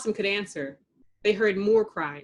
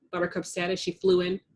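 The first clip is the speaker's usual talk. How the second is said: ordinary delivery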